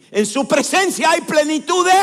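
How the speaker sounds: high, loud scripture shout; shouted proclamation. Real, not acted. Preacher prompts congregation with a verse, shouting it. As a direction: cry out the verse and leave it hanging.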